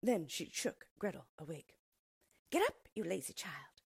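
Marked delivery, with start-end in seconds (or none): none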